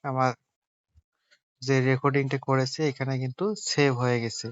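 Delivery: ordinary talk, nothing marked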